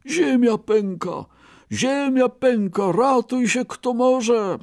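high-pitched cry of alarm; panicked cry. A narrator's reading. Frightened rabbit fleeing the cracking earth, crying out. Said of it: "krzycząc".

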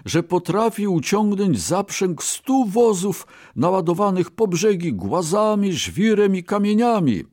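high-pitched, sustained brag; grand boasting. A narrator's reading. Brahmin brags of his ox to merchant, boastful.

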